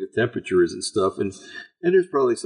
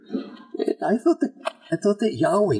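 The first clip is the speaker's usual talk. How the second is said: mock surprise; 10 semitones higher than usual. Mocking disbelief, high falsetto.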